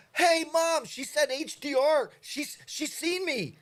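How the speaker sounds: put-on a six-year-old who just got noticed on TV; excited little kid. As a child telling his mom the TV saw him, squealing high and excited. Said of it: "And I'd be".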